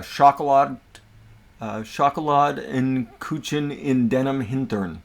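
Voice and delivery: slow, hammed-up German accent. Shown as a demonstration. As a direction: lay on a bad German accent. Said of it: "I can't do a very good German accent".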